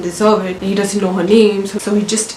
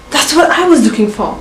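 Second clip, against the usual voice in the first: higher-pitched, acted eureka moment; excited discovery; 6 semitones higher, 4 dB louder than usual. Student hears the word he sought in class, excited discovery. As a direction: play up a student's sudden realisation.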